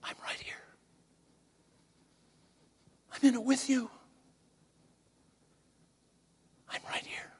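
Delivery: tender, hushed reassurance, partly whispered; lightly voiced Jesus, soft and reassuring; gentle voice of Jesus. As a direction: comfort someone close by in a soft voice. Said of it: "and he says".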